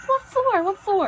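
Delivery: high, loud, challenging question; mock-incredulous man, loud and high; retold disbelief. Man retold refusing wife's request.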